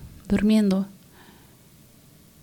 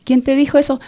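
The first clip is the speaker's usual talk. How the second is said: higher and much louder disbelief; mother can't believe what she just heard; mother reacts to her daughter's answer with surprise; astonished mother; 5 semitones higher, 6 dB louder, faster than usual. Retold or imitated.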